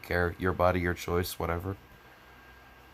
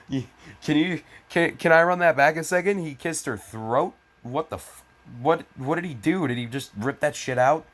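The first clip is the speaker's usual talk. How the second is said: incredulous; 6 semitones higher than usual. Real, not acted. Reader stunned by the line he just read. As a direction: gasp it out in shock.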